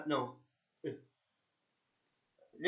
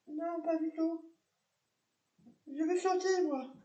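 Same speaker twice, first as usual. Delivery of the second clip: much higher, slower put-on voice of a tipsy woman; drunk woman; 12 semitones higher than usual. Drunk woman answering the police officer, high put-on voice. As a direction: play the drunk lady, high and dreamy.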